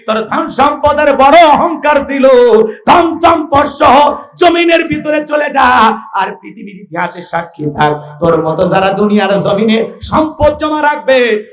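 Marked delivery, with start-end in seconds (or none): 0.1-6.0 thundering condemnation. Acted. Prophet condemns proud Karun, high and thundering. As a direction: pronounce judgement in a ringing voice.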